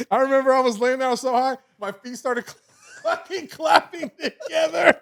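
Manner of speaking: high voice squeezed by laughter; cracking up. Real, not acted. Storyteller recalling his feet clapping, real laughing voice. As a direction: tell it while losing it laughing.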